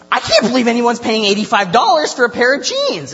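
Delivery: high, loud mock outrage; upset woman. Shopper complaining to Claire about jeans prices, mock-upset outburst. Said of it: "She's, like, really upset".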